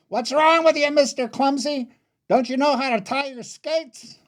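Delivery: high-pitched teasing yell; yelled taunt. Acted. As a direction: yell it across the ice, mocking a clumsy brother. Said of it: "she turned back and yelled at me".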